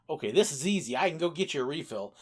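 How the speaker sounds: ordinary talk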